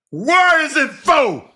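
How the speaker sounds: high, loud, slow yell; mock outrage shout. Real, not acted. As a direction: bellow it in exaggerated outrage.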